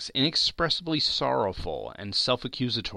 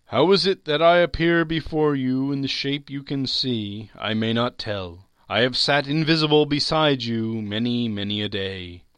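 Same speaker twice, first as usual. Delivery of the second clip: raised, carrying spectral voice easing off at the end; solemn ghost; 5 semitones higher, 6 dB louder than usual. Acted. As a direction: intone it as a mournful spirit. Ghost speaks in the story.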